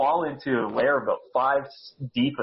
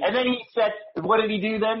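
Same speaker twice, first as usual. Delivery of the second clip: usual speaking voice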